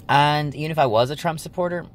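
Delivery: normal talking voice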